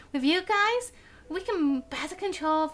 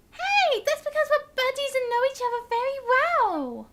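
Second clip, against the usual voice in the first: squeaky, loud protest; high Paimon voice; 10 semitones higher, 4 dB louder than usual. Acted. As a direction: play the indignant little fairy companion.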